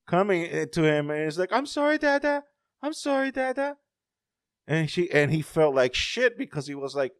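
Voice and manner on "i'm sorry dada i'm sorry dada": put-on very high-pitched small child's apology; little daughter. Daughter apologizing to her daddy, in a high child voice. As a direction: imitate a little girl saying sorry. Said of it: "he saw his daughter".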